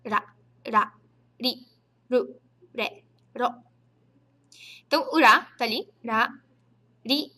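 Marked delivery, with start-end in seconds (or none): 0.0-3.5 "ra, ri, ru, re, ro" with a tap halfway between r and l; touch the tongue lightly, neither r nor l. Shown as a demonstration. Teacher drills students on the sound between R and L. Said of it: "entre um R e um L".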